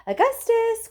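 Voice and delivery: high, loud call across the room; anxious mother calling her son. Acted. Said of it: "called out Missus Gloop".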